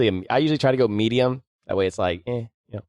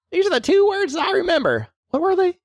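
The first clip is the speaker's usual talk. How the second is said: high, put-on Southern woman's voice; Southern woman accent. Speaking to her co-host, a high Southern woman's accent. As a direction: play a Southern lady asking sweetly. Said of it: "I gave you a, uh, Southern accent".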